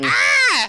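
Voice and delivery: high sudden yelp of alarm; hammed-up scream of surprise; mock shocked shriek. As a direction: shriek in fake horror. Player reacts to the reveal.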